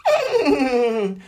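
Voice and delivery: high mocking laugh; haughty horse laugh. A narrator's reading. Proud horse laughing at the salamander, mocking. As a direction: laugh down at her.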